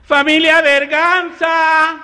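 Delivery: very high, loud, drawn-out sing-song call; camp mailman call. Retold or imitated. Mailman calling out at the door, very high and drawn out.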